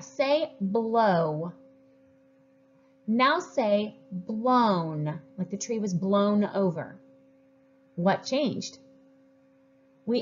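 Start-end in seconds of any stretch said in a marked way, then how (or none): 0.0-5.2 slow phonics prompt to second graders; slow, clear teacher prompt. Shown as a demonstration. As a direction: stretch blow then blown out slowly. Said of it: "say another word".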